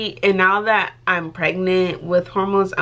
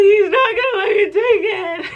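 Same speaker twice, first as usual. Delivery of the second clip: much higher and louder, crying and laughing at once; mock wailing; 12 semitones higher, 5 dB louder than usual. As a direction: wail it like a melodramatic teen, laughing. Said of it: "And I was like".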